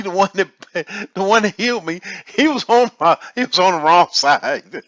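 real laughter breaking up the words; laughing. Real, not acted. Man telling how his healer stood on the wrong side, laughing. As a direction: talk through rising laughter.

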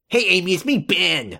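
lightly put-on goofy voice; co-host parroting his friend's silly character voice; mocking friend's character voice. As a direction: tease the co-host by copying his voice. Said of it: "the voice I do for George".

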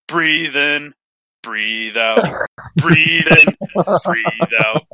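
hammed-up meditation guide; mock meditation tape. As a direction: imitate a relaxation recording with a grin.

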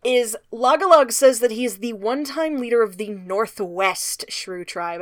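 usual speaking voice

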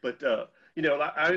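normal talking voice

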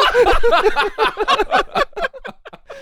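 very high, trailing laughter; laughing fit. Real, not acted. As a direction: crack up at your own impression. Laughing at own mocking.

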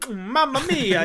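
hammed-up Italian accent, pitched high; Jared Leto. Podcaster mocking an actor's film role, cartoonish Italian accent. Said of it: "borderline racist Italian accent that you're doing".

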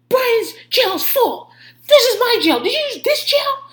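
high, loud hysterical accusation; imitated screaming tirade. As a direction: shriek it like a man losing it. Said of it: "he's screaming at Frank".

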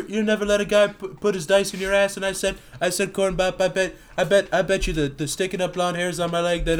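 put-on rambling Southern-tinged old politician, stumbling and restarting; Joe Biden impression. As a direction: ramble through a tall tale, tripping over words. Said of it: "turning Joe Biden into a Southern Dixiecrat".

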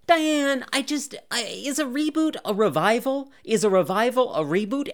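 high-pitched, hammed-up confusion; mock bewilderment. Host pleading with co-host over what a reboot is, mock-baffled.

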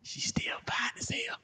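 high breathless whisper. Retold or imitated. Choked man whispers about the aunt after drinking water, hoarse. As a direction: whisper it through the coughing. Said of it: "my next words was".

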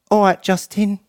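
high-pitched, put-on London English accent. London friend greeting his mate in the Uber, imitated London accent. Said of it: "I love your accent".

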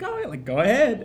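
high, loud, playful brush-off; joking high voice. Retold or imitated. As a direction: tease it out high. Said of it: "like a funny".

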